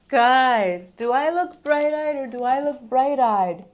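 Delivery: loud, drawn-out delighted question; excited exclamation. Real, not acted. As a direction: call out to viewers, thrilled. Thrilled by the concealer result.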